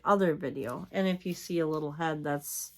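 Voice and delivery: usual speaking voice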